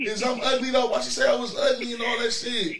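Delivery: high, offended complaint; indignant whine. Real, not acted. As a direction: whine like you've been wronged. Host upset at being called ugly.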